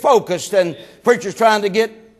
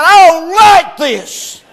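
complaining wife; 6 semitones higher, 6 dB louder, much slower than usual. Retold or imitated.

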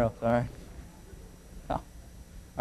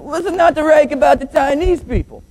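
high-pitched put-on Chinese accent; mock Chinese accent; 13 semitones higher, 3 dB louder than usual. Co-pilot answering the pilot, mock Chinese accent. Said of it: "The Chinese man says".

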